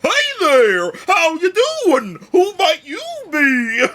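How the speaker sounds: loud, high and bouncy cartoon guy; energetic cartoonish voice. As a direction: greet them big and over the top. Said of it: "What voice do I even give this guy?".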